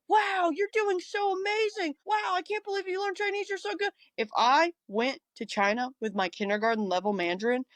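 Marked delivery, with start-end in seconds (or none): 0.1-3.9 gushing praise. Retold or imitated. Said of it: "everybody's like".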